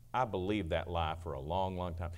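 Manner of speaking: quiet, heavy confession of the lie. Shown as a demonstration. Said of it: "It is a lie".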